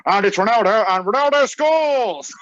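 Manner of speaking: loud, high mock goal commentary, building up; football commentator. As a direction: call the goal like a commentator, stretching the last words. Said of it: "you look like a football pundit".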